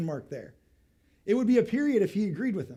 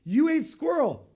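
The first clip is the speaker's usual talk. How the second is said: high, slow "You ate squirrel" with stress on "You"; as though this cannot be true; disbelieving question; 9 semitones higher, 7 dB louder, slower than usual. Shown as a demonstration. Preacher demonstrating to the congregation, high disbelieving question. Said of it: "I'm asking it as a question".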